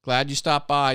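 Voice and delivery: ordinary talk, nothing marked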